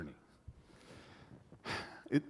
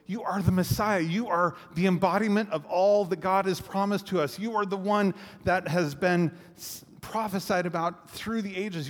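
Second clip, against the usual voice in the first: rising proclamation; 6 semitones higher than usual. Retold or imitated. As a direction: proclaim it with growing conviction. Peter proclaiming Jesus the Christ.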